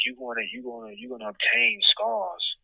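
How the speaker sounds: usual speaking voice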